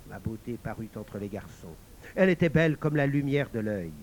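ordinary delivery

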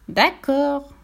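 high, reluctant piglet sigh. A narrator's reading. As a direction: give in with a small high sigh. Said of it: "soupira le porcelet". (soupir résigné de porcelet)

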